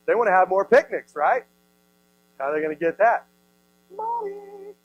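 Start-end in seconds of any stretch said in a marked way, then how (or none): 3.9-4.7 a very high, soft squeak of money; punchline. Acted. Pastor answering his own question with a comic squeak. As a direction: tease the answer out lightly.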